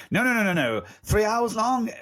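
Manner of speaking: high-pitched, disbelieving refusal; mock-appalled, rising in disbelief; incredulous protest. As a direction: balk at it, pitch jumping up. Acted horrified refusal of a long film.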